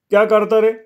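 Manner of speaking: high-pitched inquisitive shout; calling guest. A narrator's reading. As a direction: call the question out in a high voice. Guest calls out to the boy.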